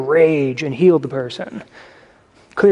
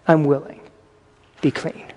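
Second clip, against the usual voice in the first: softer, hushed words of healing; tender, quiet; 3 semitones lower, 5 dB quieter than usual. Retold or imitated. Jesus touching the leper, quiet and tender. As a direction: let it fall gently and deliberately. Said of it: "Very intentionally touches the leper".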